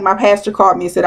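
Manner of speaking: ordinary talk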